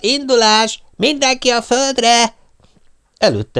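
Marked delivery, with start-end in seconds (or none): 0.0-2.4 high, loud, slow commanding shout; shouted orders. Acted. Pop ordering the spectators down, loud slow command. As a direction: bark the order to hit the ground. Ordering everyone down before launch.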